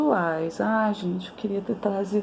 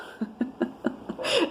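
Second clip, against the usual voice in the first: laugh; 7 semitones higher, 6 dB quieter than usual. Real, not acted. Laughs after silly voice demo.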